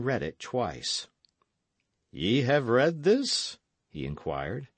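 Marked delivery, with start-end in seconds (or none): 2.1-3.6 slow, measured question rising on "this"; an Earl's measured inquiry, lightly acted; probing question. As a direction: ask slowly, pitch lifted to a questioning end. Earl questions Dick about letter.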